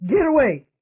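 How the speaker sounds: high, loud panicked shout; frantic cry. A narrator's reading. Michael screaming at the ghosts to leave him, high and frantic. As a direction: scream it to drive them back. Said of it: "he cried".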